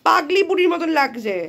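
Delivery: loud teasing; teasing mock exasperation. Real, not acted. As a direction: tease her, still worked up. Teasing child after scolding.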